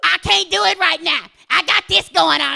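loud mock excuse-maker; people making excuses. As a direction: mimic a frantic brush-off, loudly. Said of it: "Listen to what they say to you".

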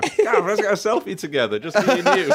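high, loud laughing imitation; excited laughing quote. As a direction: squeal it through laughter.

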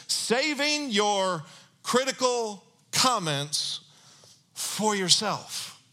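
each word drawn out slowly; slow scripture quote. Acted. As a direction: read the verse very slowly. Quoting the verse slowly.

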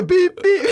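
loud high beep beep like a dead line; shouted beep beep through laughter; mimicked hang-up tone. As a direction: play the phone cutting off, loud and high. Imitating phone hang-up tone.

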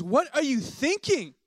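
high, loud, drawn-out mock outrage; hammed-up exasperated telling-off; exasperated wife scolding. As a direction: scold him loudly, pitch soaring in disbelief. Wife scolds him after overeating.

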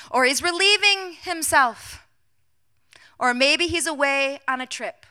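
loud, high-pitched jeer; mocking taunt. Elijah mocking the prophets' absent god, loud taunt. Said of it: "Elijah begins mocking them".

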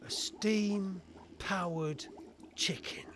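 very slow, incredulous repetition; deadpan disbelief. Acted. Inspector repeats the inventor's absurd reveal, in slow disbelief. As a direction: drag it out, dropping to a near-whisper on the last word.

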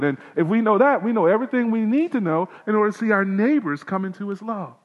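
energetic sermon delivery; preaching. Acted. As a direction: lift it back up to full preaching. Raised preaching again.

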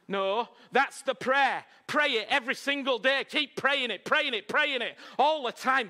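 high, insistent, rhythmic preaching; exhorting preacher. Real, not acted. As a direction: drive each repetition home to the congregation. Preacher urges congregation to pray.

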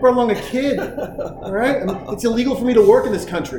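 high-pitched, hammed-up griping; mock indignant protest. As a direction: argue back at dad as the overworked kid. Kid's imagined protest to dad.

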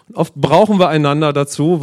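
ordinary delivery

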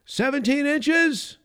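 high called-out answer from the back of the room; a voice in the crowd shouting the answer; shouted from the back. Retold or imitated. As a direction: call it out from the back of the hall, slow and high. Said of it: "someone shouted from the back".

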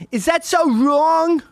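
very high, loud, drawn-out mock plea; hammed-up wail. Comic repeating his plea to the audience, hammed-up wail. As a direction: wail it out as an exaggerated protest.

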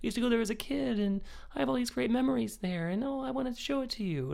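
high, eager husband voice; imitating husband. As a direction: mimic him gushing about childhood memories. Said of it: "He's like".

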